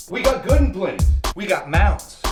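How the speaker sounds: excited sales pitch. Acted. App pitchman listing candy for a customer, excited. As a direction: sell each candy with gusto.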